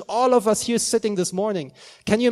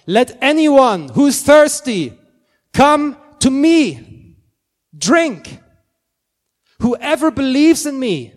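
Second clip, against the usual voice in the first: slow, much louder calling out to the crowd; loud proclamation; 5 semitones higher, 9 dB louder, slower than usual. Retold or imitated. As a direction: proclaim it slowly at full volume, as if crying out to a crowd. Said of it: "said in a loud voice".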